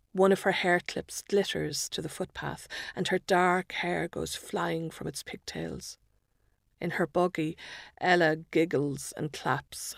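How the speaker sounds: ordinary delivery, nothing marked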